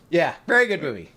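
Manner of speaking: ordinary delivery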